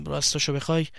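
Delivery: halting, subdued admission; hesitant confession. Acted. Caught boy begins explaining to his friend, hesitant. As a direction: start reluctantly, then trail away.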